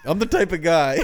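mock catchphrase. Mocking Tom's catchphrase loudly.

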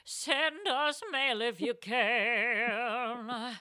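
very slow, stretched words; slow pleading drawl; drawn-out plea. Acted.

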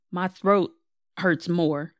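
ordinary delivery

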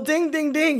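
high, bright sing-song ding; game show ding. Pretend. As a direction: ring it out like a quiz bell. Game-show style correct-answer chime.

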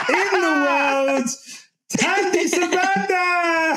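slow, drawn-out, high announcer call; big stretched-out host introduction; hype intro. Acted. Hyping a guest intro.

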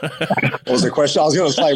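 chuckling while he asks it back; laughing. Real, not acted. Laughing at a repeated question.

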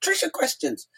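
excited greeting. Retold or imitated. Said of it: "and he said".

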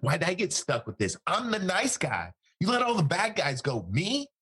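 high-pitched mock complaint, peaking on "Me"; imitated indignant protest. Bodyguard blaming Saddam for his capture, indignant imitation. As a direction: protest as the wronged nice guy, voice climbing.

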